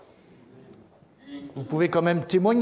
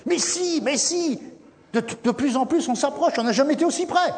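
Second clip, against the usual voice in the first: high eager reassurance; 10 semitones higher than usual. Acted. Caleb's cheerful answer.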